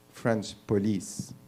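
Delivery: French police said with a French accent, Frahnsh poh-LEESE. Shown as a demonstration. Comic says police as if he were French, French accent. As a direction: say French police the way a French person would. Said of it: "if you're French".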